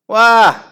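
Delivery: put-on high, loud babyish wah; mock wail. Weepy William wailing in class, hammed-up wah. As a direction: wail like a whiny kid. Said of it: "wailed Weepy William".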